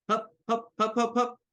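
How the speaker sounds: high, quick bursts of "hup"; acrobat hups. Acted. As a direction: call out hups like tumbling acrobats. Acrobats forming a pyramid.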